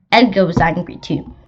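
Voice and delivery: ordinary delivery, nothing marked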